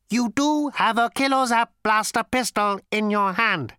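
high, loud, mechanical robot voice; cold, lifeless robot. A narrator's reading. Robot confronts Zaphod about his gun, cold and lifeless. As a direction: ancient robot with a cultured rasp, pitched high. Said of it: "robot's voice was like its body, cold, sleek, and lifeless".

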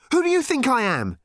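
put-on high, loud furious teenage girl; angry demand. A narrator's reading. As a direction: hurl the question at him in anger. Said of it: "she demanded angrily".

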